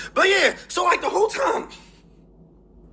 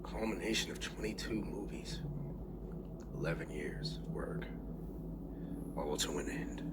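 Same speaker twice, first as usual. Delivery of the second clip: near-whisper, much softer, fighting tears; choked up; 10 semitones lower, 17 dB quieter than usual. Real, not acted. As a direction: let the voice almost vanish with emotion. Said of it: "I gotta stop sounding like I'm about to cry".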